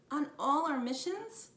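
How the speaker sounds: put-on a touch of a boy's wonder; amazed. A narrator's reading. Jack learning Morgan was with them, amazed.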